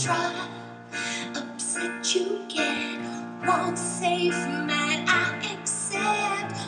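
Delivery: ordinary talk, nothing marked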